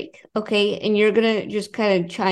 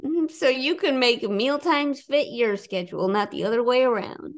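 ad read; 3 semitones higher than usual. Acted. Reads sponsor ad.

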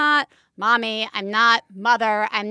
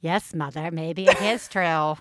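put-on creepy child; 8 semitones lower, 4 dB quieter than usual.

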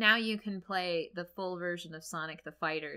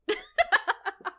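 high laugh; 8 dB louder than usual. Real, not acted. Cohost laughs after her reveal, high giggle. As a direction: burst of high loud giggling.

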